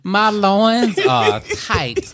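very slow, drawn-out Southern belle swoon; Southern belle accent. Acted. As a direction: draw it out like a Southern plantation lady. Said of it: "Do it again".

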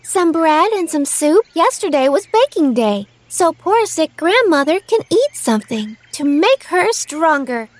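put-on high, sweet little girl; bright young girl promising. Girl answers the wolf.

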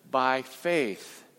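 ordinary delivery

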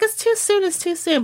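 high, fussy insistence that it's too early; playful mock refusal; mock protest. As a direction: protest the season coming early. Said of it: "'Cause before I was like".

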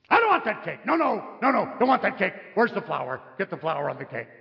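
fussy refusal, rapid and indignant at first; fussy demand. Acted. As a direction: play a picky guest objecting. Acting out a fussy cake demand.

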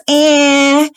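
high, drawn-out nasal wail on "Eh"; crying vowel. Shown as a demonstration. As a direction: make "Eh" cry before a nasal. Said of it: "It makes them cry".

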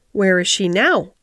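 usual speaking voice

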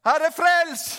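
loud, high, urgent shout; cry to the Lord. Acted. Sinking Peter calls on the Lord, shouted plea. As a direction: call out for rescue at full voice. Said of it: "Så han ropte".

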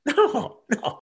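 normal talking voice

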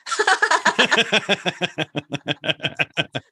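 long laughter yoga belly laugh; long forced laugh. Shown as a demonstration. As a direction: laugh at yourself on cue. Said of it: "No, start laughing".

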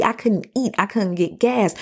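normal talking voice

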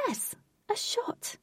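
"Yes, a shot" very high, soft and slow; high confirming reply. A narrator's reading. Fairy confirming her offer, high slow "Yes, a shot". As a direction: squeak "Yes, a shot" softly, drawn out.

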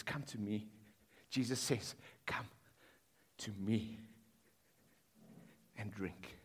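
low, hushed, tender call; soft invitation. Retold or imitated. Jesus inviting the thirsty to come, hushed and tender. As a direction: draw them in gently, almost under the breath.

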